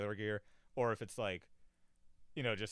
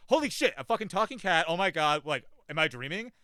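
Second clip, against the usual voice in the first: loud, higher mock freak-out; mock shock; 5 semitones higher, 8 dB louder than usual. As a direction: imitate someone stunned in disbelief. Imagined shock at a talking cat.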